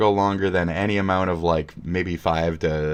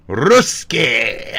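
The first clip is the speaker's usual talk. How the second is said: louder, higher bark of the word Rusky; mock shouted slur; 4 semitones higher, 7 dB louder than usual. Shown as a demonstration. Streamer yelling Rusky at a troll in chat, mock shout. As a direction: yell Rusky like a mock insult. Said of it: "I'm supposed to say it like that".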